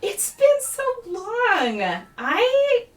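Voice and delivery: put-on a playful, unsettling kid teasing an old friend; gleeful creepy child. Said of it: "chuckles a little bit and claps and just goes".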